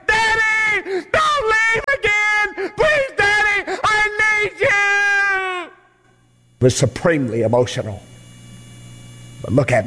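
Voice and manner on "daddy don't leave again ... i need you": put-on high, slow, sobbing child's cry; screaming little girl. Daughter clinging to her soldier daddy's leg, high crying plea. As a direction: plead like a child who won't let go. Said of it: "she screamed out for her daddy".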